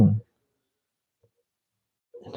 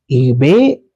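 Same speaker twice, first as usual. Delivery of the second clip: normal talking voice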